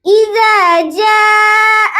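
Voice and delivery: slow, melodic tajweed recitation; Quran recitation. Shown as a demonstration. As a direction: chant each verse slowly, like a teacher. Teacher recites Surah An-Nasr. (استاد کی سست تجوید والی تلاوت)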